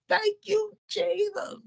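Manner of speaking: very high, softened imitation of a shout; overjoyed praise. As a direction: squeal thanks without full volume. Re-enacts his shout of praise.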